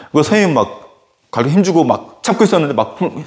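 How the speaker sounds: ordinary delivery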